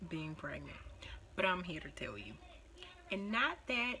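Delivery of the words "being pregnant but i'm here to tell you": conspiratorial whisper. Real, not acted. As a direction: lean in and whisper a secret. Confiding secrets to girlfriends.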